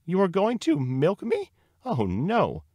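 high, loud, slow outrage; deeply indignant. A narrator's reading. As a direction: draw it out in affronted disbelief. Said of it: "The cow was deeply indignant".

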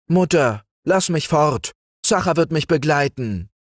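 high, eager plea to mother; boy begging his mother to leave, high; pleading. A narrator's reading. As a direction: beg your mother to let you go. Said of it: "bat er".

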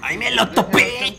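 laughing mock quote; mocking mimicry. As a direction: mimic the thief, hammed-up and stretched. Mimicking the thief from clip.

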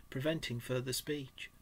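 ordinary delivery

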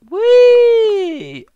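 high squealing whee, very loud; gleeful squeal. Acted. Jumping into a frozen pool, squealing with glee. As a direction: squeal like a kid hitting the water.

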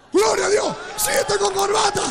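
high, loud cry of praise; exultant, projected exclamation; jubilant shout. Retold or imitated. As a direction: shout it out, overjoyed at the miracle. Acts out joy at miracle.

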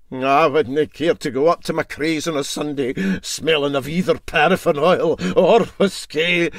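mild huffy objection; MacPhail the engineer. Acted. Engineer refusing to visit smelling of whiskey, mildly huffy. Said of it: "said MacPhail".

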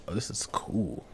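hushed, breathy aside; whispering. Real, not acted. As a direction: whisper it to yourself. Quiet exploration, horror game.

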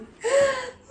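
sharp high gasp; excited gasp. Real, not acted. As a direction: gasp in delight. Overwhelmed gasp at shoes.

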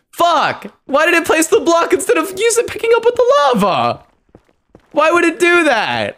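frustrated gamer outburst, high and loud; raging at the game. Real, not acted. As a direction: scream at the game for betraying you. Game misplaced block, raging.

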